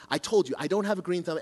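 ordinary talk

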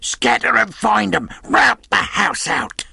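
high, forceful command; impatient villain's yell; insistent shouted order. A narrator's reading. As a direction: drive the gang out to search. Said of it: "reiterated Pew".

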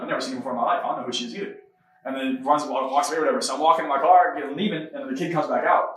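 ordinary delivery

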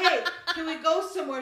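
a loud, laughing hey, can we go somewhere; loud plea, laughing. Retold or imitated. Wife asking husband for a trip, loud and laughing. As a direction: call out eagerly with a laugh. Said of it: "I say".

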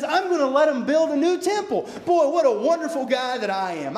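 high, loud, self-satisfied king voice; hammed-up boastful ruler; boastful new king. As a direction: puff up like a proud king congratulating himself. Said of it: "he says".

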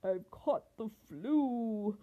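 slow, drawn-out lion roar; sick lion's storybook roar. A narrator's reading. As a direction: roar it slowly as a poorly lion. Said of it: "the lion roared".

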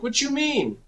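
high, squeaky confused question; baffled. Retold or imitated. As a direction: son baffled by his dad's running advice, high squeaky question. Son baffled by dad.

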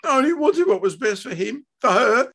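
high, loud tearful plea; sobbing protest. A narrator's reading. Wife explaining herself to her husband, sobbing. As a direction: wail it, upset and defensive. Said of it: "she sobbed".

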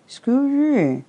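loud, high garbled blurt; mouth full. A narrator's reading. Tigger, mouth full, excuses himself before going outside, garbled. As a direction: excuse yourself round a mouthful. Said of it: "his mouth was full of acorns".

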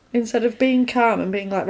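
usual speaking voice

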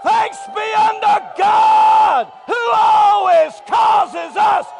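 very high, slow, full-force preaching scream; ecstatic, drawn-out victory shouts; screamed praise. Real, not acted. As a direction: stretch every cry to the rafters. Screaming scripture and praise in church.

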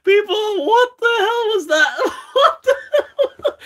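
high squeal through laughter; laughing hard. Real, not acted. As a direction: crack up while asking. Reacting to a funny clip.

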